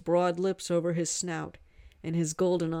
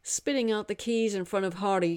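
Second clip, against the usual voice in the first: plain reading voice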